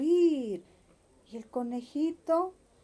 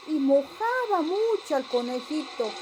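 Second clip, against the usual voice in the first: ordinary delivery, nothing marked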